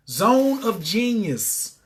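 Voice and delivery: each word drawn out slowly; slow emphatic. Acted. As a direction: spell out the phrase like a slogan. Coach slowly names his concept.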